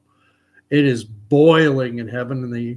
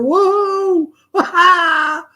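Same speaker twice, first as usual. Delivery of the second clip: cheering; 7 dB louder, much slower than usual. Acted. Fans cheering in the joke.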